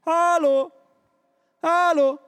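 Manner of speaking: loud, high call of hallo, hallo. Shown as a demonstration. Preacher calling out to show what rufen means, a loud high hallo. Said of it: "zum Beispiel mit rufen".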